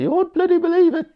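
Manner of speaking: very high, loud West Country voice, bursting with news; squeaky, excited West Country accent. Retold or imitated. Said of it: "as Brody says in the West Country accent".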